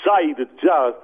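lightly voiced politician, slow; hesitant John Howard attempt; John Howard impression. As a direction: try out the prime minister. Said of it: "John Howard".

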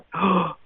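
sudden relieved intake of breath; re-enacted gasp. Man recalling a burden lifting off him, re-enacted gasp. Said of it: "like I took a breath for the first time".

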